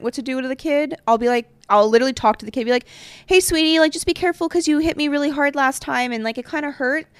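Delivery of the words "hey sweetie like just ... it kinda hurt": put-on higher, sugary voice talking to a child; sweet and nice. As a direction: gently warn a little kid, sweetly. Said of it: "be nice about it".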